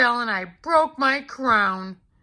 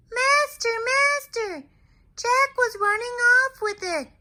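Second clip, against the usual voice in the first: much higher, a magic harp crying for its master; calling harp. Acted. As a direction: call out for help as the singing harp. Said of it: "my harp is calling".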